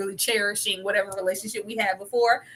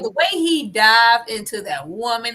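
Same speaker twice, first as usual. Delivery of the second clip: usual speaking voice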